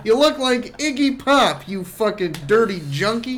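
loud, high taunting outburst; acting out a loud barroom insult; shouted retold insult. Acted insult repeated to the bartender.

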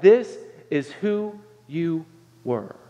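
very slow, grave delivery; slow solemn refrain. Acted. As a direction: let it sink in slowly.

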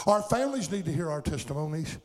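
ordinary talk

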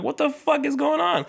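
very high-pitched, baffled squeal; retold bewilderment. Recalling fear his house was being raided, high bewildered voice. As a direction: pitch it way up, replaying total confusion. Retelling fear of a drug raid.

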